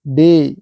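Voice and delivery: डे, ending on a held ए sound; ए vowel in डे. Shown as a demonstration. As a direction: say डे with a long "ay" vowel. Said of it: "ए की मात्रा उच्चारण करते हैं".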